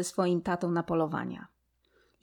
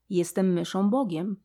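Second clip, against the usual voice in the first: ordinary delivery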